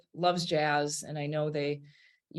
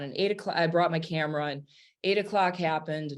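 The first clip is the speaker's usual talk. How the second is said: ordinary talk, nothing marked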